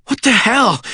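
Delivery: high, winded exclamation; labored voice. A narrator's reading. Sean confronts his friend after running, labored voice. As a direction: demand it while out of breath. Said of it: "Sean's voice was labored".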